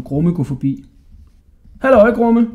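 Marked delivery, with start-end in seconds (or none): 1.8-2.5 high, loud call. A narrator's reading. Hodja hailing the passing jailer, called out high and loud. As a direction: shout a hello along the passage. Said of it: "råbte Hodja".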